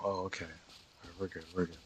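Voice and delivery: ordinary talk, nothing marked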